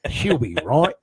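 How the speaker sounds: broad, hammed-up Aussie ocker voice; Australian accent. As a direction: lay on a thick Australian drawl. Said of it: "that's my Australian accent".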